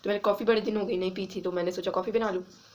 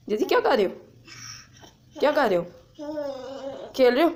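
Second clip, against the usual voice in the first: sweet dog talk; 6 semitones higher than usual. Real, not acted. Calling the dog playfully.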